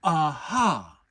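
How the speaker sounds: Pooh trying out a loud Aha; rehearsing the word; practising Aha. A narrator's reading. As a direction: Pooh testing it.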